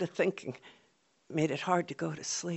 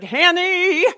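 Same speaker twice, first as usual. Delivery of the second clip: loud high call of her name; loud, much higher shout; 12 semitones higher, 11 dB louder than usual. Retold or imitated. Mother calling from the house.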